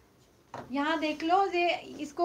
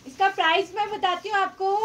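loud, higher voice projected to the phone; ज़ोर से बोलना; 5 semitones higher, 7 dB louder than usual. Real, not acted. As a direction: say "इसका price" loudly so viewers hear clearly. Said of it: "अभी जोर से बोलती हूँ". (ज़ोर से, ऊँची आवाज़ में कीमत बताना)

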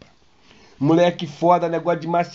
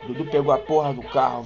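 ordinary talk

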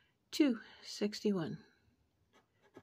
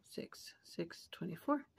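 whispering; 2 semitones lower, 10 dB quieter than usual. Real, not acted. Checking numbers under breath.

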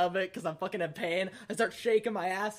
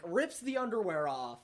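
ordinary talk, nothing marked

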